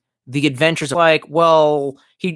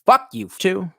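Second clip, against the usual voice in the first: higher, snippy comeback; mock retort; 6 semitones higher, slower than usual. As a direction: toss it back like a mock insult. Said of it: "and I was like".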